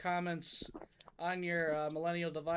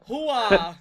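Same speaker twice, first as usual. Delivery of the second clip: loud gung-ho soldier; 3 semitones higher, 12 dB louder than usual. Retold or imitated. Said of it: "Al Pacino going".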